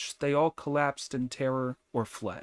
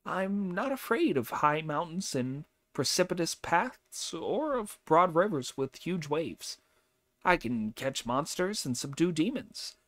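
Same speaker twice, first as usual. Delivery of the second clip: higher, brisk, cocky monkey hero voice; boastful Monkey character; 6 semitones higher than usual. Acted. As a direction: brag about your powers, voice pitched up. Monkey boasts to the old man.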